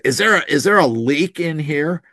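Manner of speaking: high, agitated demanding king; hollering king. Retold or imitated. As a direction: shout at the generals, suspicious and upset. He's hollering at his generals.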